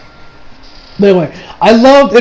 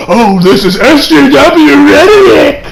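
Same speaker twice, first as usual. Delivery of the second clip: slow, higher, mocking whine with a laugh; mocking YouTuber; 6 semitones higher, 5 dB louder, slower than usual. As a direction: sneer it out like a smug critic. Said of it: "already made ten videos about, like".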